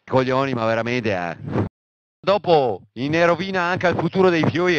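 ordinary delivery

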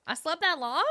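loud, high rising disbelief; astonished. Real, not acted. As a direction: ask it loudly, pitch jumping up in surprise. Surprised on waking from nap.

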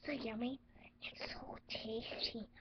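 unvoiced, savouring whisper. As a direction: breathe it, no voice. Savouring Pepsi secretly.

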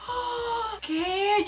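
high-pitched gasp into a shocked question; astonished gasp. Real, not acted. Vlogger seeing a viral dress trick, astonished. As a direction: squeal in amazed disbelief.

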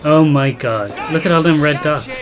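alarmed surprise. Real, not acted. Swarm of enemies on screen.

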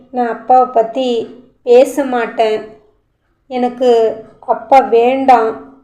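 a hint of a little child repeating a lesson; childlike voice. Little boy promising his aunt, lightly childlike voice. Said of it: "தனது மழலை குரலில்".

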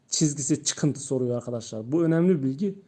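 usual speaking voice

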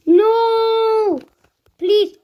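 loud, high, drawn-out plea; high-pitched wail of protest; desperate cry. Real, not acted. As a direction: cry out loudly, begging. Pleading as the game goes wrong.

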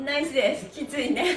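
usual speaking voice

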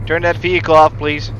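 loud order over engine noise; shouting. Real, not acted. Ordering driver to cut engine.

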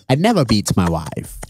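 put-on Lee Kuan Yew's accent. Founding father denies beating his wife, low British accent. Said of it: "is that a good British accent?".

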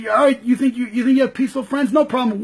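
ordinary delivery

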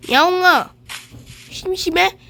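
loud call to a pet; calling the cat. Real, not acted.

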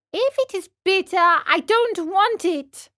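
put-on high-pitched, childish refusal; balking puppet boy. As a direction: voice a stubborn little puppet.

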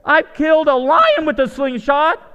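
eager boast. Retold or imitated. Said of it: "starts encouraging other people".

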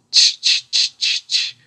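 sharp voiceless "tch" noises; monkeys' tch-ing. A narrator's reading. Monkeys shaking both hands back at the peddler, sharp mocking tch-ing. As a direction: chatter back in crisp tch sounds. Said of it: "the monkeys only shook both their hands back at him and said".

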